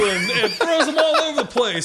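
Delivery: ordinary talk, nothing marked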